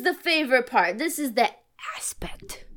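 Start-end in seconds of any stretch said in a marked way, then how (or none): 2.0-2.6 whispered. Real, not acted. Dramatic reveal of the word.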